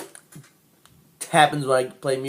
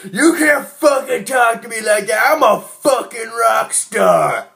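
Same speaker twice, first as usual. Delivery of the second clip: put-on much higher, louder drunk rage; drunken shout; 10 semitones higher, 5 dB louder than usual. Drunk to the studio manager, furious shout. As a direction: yell it like a wounded rock star. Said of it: "I go".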